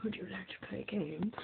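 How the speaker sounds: hushed creepy man voice; sweet creepy coaxing. Acted. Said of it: "This is the creepy man".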